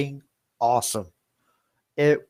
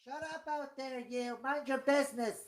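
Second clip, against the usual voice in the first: high, much softer, nasty aside; Agnes telling someone off; 13 semitones higher, 14 dB quieter than usual. Retold or imitated. Agnes turning away from the phone to scold someone, high and soft. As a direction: hiss it high at someone in the back. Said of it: "Agnes is getting nasty with somebody in the back".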